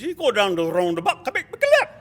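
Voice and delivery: fast, high, loud parroting of the local; imitated local man. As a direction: parrot the garbled directions at full volume. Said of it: "That's what he said".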